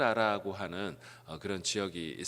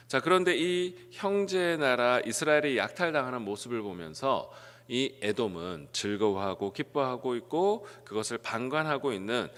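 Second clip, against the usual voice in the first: usual speaking voice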